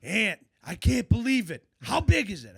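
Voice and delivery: high, loud stunned excitement; loud, high retold astonishment; bug-eyed amazement. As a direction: imitate a wide-eyed angler bursting with disbelief. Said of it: "he's just bug-eyed".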